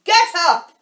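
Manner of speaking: high shrill shout; shrill, loud. A narrator's reading. Queen orders the gardeners up, shrill and loud. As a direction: bellow a shrill two-word order. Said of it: "in a shrill, loud voice".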